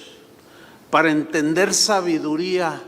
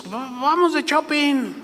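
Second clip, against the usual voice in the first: higher, excited invitation to go shopping; eager pastor; 9 semitones higher than usual. Retold or imitated. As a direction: call the group out like an eager pastor. (un pastor invitando a los demás a ir de compras, voz alta y animada)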